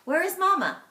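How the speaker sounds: high, loud, playful question; animated storytelling. Shown as a demonstration. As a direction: ham up the question for the kids. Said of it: "So here's an example".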